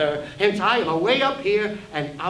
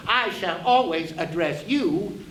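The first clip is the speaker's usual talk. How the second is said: ordinary delivery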